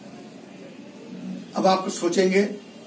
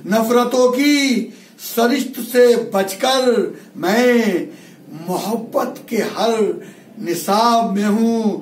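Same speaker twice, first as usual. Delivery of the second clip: slow, higher-pitched declaimed verse; ghazal recitation; 3 semitones higher, much slower than usual. Acted. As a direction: stretch each phrase like a mushaira poet. Repeats the couplet in recitation.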